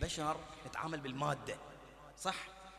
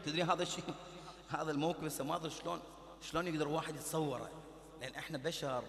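ordinary talk

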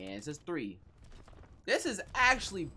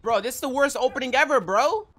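loud complaint; 8 semitones higher, 10 dB louder than usual. Real, not acted. Complaining about bad opening.

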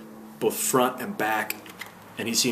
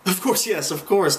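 higher, moderately raised exclamation; excited shout; 8 semitones higher, 4 dB louder than usual. A narrator's reading. Man told who he is, mildly shouting in excitement. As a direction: call it out in eager realisation. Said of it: "the man shouted".

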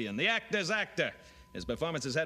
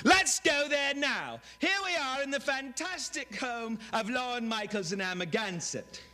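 Robin Leach; 10 semitones higher than usual. Retold or imitated. Imitating a TV host gushing over a rich home. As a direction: gush like a lavish TV host. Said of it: "Robin Leach, like".

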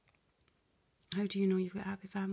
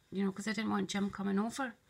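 usual speaking voice

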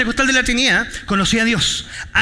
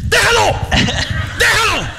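commanding shout; 9 semitones higher, slower than usual. Retold or imitated. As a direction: yell to make them drop it. Preacher yelling at drug users.

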